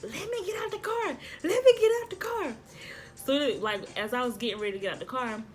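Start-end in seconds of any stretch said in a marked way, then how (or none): 0.0-2.5 very high urgent squeal, building louder; frantic excitement. Retold or imitated. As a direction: beg frantically to be let out. Acted fan frantic to get out.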